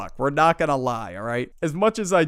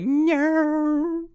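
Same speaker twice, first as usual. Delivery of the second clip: whiny no; 12 semitones higher than usual. Retold or imitated. Kid starts crying.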